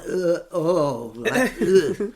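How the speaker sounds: loud disgusted gagging groans; mock gagging at the stench; retching noises. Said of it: "I'm like".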